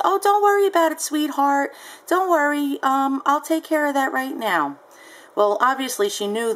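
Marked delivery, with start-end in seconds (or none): 0.0-4.8 sugary nonchalant buyer. Retold or imitated. Buyer calling the seller sweetheart, syrupy. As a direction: sweet-talk to brush her off. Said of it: "She was very nonchalant".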